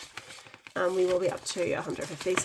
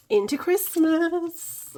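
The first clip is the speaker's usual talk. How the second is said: excited; 10 semitones higher, 7 dB louder, slower than usual. Real, not acted. Excited about saving for Christmas.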